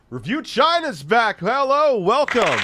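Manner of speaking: high, loud hammed-up host welcome; announcer greeting. Greeting the stream in a high hammed-up host voice. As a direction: boom it out like a show intro. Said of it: "greeting in a put-on announcer voice".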